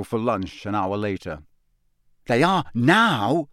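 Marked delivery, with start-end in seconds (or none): 2.3-3.5 exasperated. Acted. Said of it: "in an exasperated voice".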